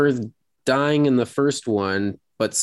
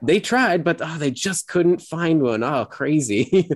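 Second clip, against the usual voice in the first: high-pitched mock regret, slowing at the end; dripping with sarcasm; 7 semitones higher than usual. Host retells actress's fake apology to critics, dripping with sarcasm. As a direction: feign helpless disbelief for the critics. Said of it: "just dripping with sarcasm".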